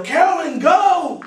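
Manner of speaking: loud high call to the wife; yell. Retold or imitated. Father yelling to his wife to run to the boy, loud and high. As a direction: shout her name to send her running. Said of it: "and I yell".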